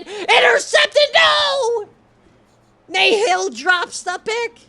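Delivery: very high, loud, slow shouted outburst; shocked shout. Real, not acted. Streamer reacting to an interception in his game, shocked shout. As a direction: yell in disbelief at the pick.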